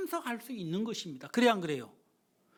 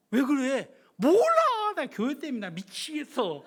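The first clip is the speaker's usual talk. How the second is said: exasperated complaint; 2 semitones higher, 7 dB louder than usual. Retold or imitated. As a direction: vent to a friend. Exchange with friend. (친구에게 높고 크게 하소연)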